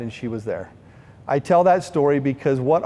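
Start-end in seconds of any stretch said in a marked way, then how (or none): 0.0-0.7 soft, fading close. Acted.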